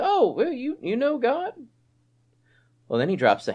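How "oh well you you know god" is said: high, sarcastic mock astonishment; mock surprise. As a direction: play James's wry question. Mock quote of James.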